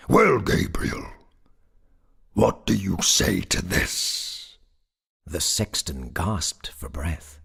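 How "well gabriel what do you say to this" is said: loud, slow goblin voice; grinning goblin. A narrator's reading. Goblin taunts the sexton after the chorus, grinning. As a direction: draw it out with a broad grin. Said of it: "grinned a broader grin than before".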